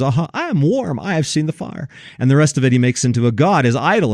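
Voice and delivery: ordinary talk, nothing marked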